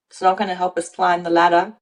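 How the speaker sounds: ordinary talk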